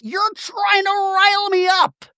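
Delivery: high, shouted imitation of an accusation; mock shout. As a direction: yell it like the angry housewife. Shannon shouting at Katie.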